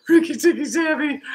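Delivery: high, laughing as he reads the name; can't keep the laugh out of it. Real, not acted. Coach laughing while reading a kid's silly team name.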